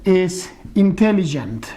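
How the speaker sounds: That man is intelligent in a clear English accent; slow, careful English of That man is intelligent; English model phrase. Shown as a demonstration. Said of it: "Tú puedes decir".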